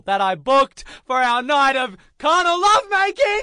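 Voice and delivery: climbing into a high, outraged shout; frustrated shout. Retold or imitated. Storyteller ranting about the wasted hotel booking, rising frustrated shout. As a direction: build to an exasperated yell. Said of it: "And are now deaf".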